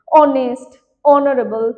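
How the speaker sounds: অনেস্ট and অনারেবল said loud, vowel first, no h; silent h. Shown as a demonstration. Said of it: "এগুলোর এইচ silent আছে". (এইচ নীরব রেখে জোরে উচ্চারণ)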